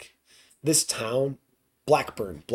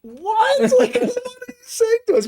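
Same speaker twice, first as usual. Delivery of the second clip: high, loud incredulous cry through laughter; loud laughing retort; laughing disbelief; 8 dB louder than usual. Retold or imitated. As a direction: act out baffled laughter, pitched way up. Retelling group's laughing, baffled reply.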